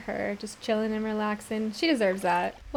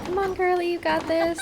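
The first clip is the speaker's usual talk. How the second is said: high-pitched encouragement to a player; cheering on; 7 semitones higher than usual. Pretend. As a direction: cheer her on, voice lifted playfully. Cheering on her losing Sim.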